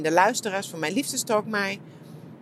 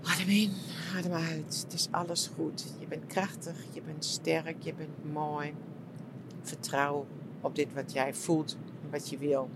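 soft, calm guided-meditation voice, much softer; 3 semitones lower, 8 dB quieter than usual. Retold or imitated. Talking herself back into calm during meditation, soft and soothing. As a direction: breathe it out gently, like coaching yourself. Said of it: "bij de volgende meditatie van".

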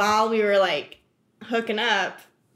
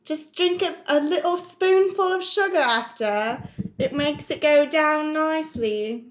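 Mary Poppins; 2 semitones higher than usual. Acted. Talking to herself as a nanny, British accent. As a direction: coax like a prim British nanny. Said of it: "like Mary Poppins in a British accent".

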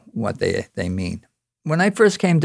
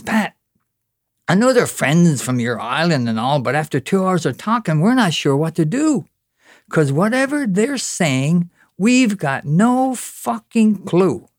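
acted fright, pitch climbing as it goes; bewildered plea, slowing right down at the end; frightened confiding; 6 semitones higher than usual. Mainlander leaning over to Pat, acted fearful bafflement. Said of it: "he leaned over and he gasped in fright".

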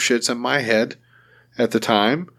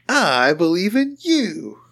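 slow, high, sing-song mock encouragement; mock sincere, higher; 9 semitones higher, much slower than usual. Cooing higher to his buddy, slow mock sincerity.

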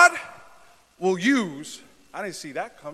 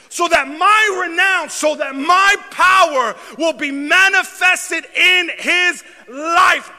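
high, loud, slow shouted proclamation; preaching at full force; 13 semitones higher, 18 dB louder, slower than usual. Acted. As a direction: thunder it out to the congregation.

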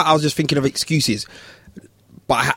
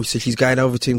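normal talking voice

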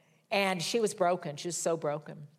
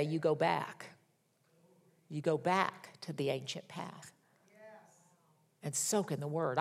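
soft inner prompting; 3 semitones lower, 6 dB quieter than usual. Retold or imitated. Holy Spirit's gentle instruction retold.

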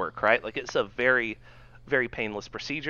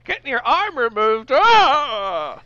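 high, loud shocked grandma; old grandma voice; 9 semitones higher, 8 dB louder, slower than usual. Retold or imitated. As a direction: shriek like a dramatic old lady. Mock grandma voice.

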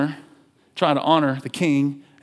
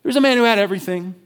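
quick, higher storytelling; fast Rhett version; 4 semitones higher, 3 dB louder, faster than usual. Shown as a demonstration. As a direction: rush it out in your own words. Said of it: "This is the Rhett version".

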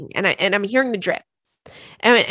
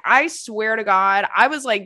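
ordinary talk

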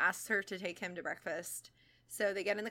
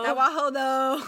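party bro voice; 4 semitones higher, 11 dB louder than usual. Pretend. Echoes the party bro bit.

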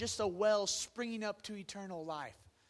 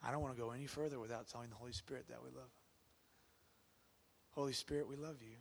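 soft, hushed prayer; 7 semitones lower, 10 dB quieter, faster than usual. Real, not acted. Preacher leading the church to pray to the Spirit, hushed prayer. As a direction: pray quietly and sincerely.

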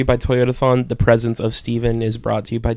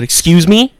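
sharp, louder, higher indignant excuse me; retelling an angry reply to a clerk; pissed off; 6 semitones higher, 7 dB louder than usual. Retold or imitated. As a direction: snap it back offended. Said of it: "Like, pissed off".

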